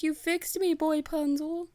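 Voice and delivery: high-pitched, hammed-up damsel; put-on high voice of a grateful girl; mock girlish voice. Healed girl to Boy Punzel, mock girlish gratitude.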